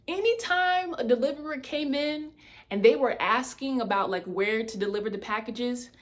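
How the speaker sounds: ordinary talk, nothing marked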